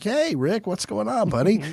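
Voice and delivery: high, soft, forced friendly greeting; awkward mock cheer. Comic greeting the neighbor he grew up with, high and forced. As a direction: fake breezy hello to an old neighbor.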